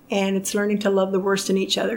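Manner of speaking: ordinary talk, nothing marked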